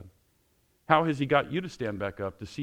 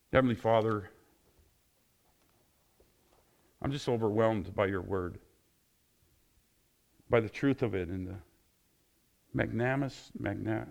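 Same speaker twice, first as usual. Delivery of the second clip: closing prayer. Acted.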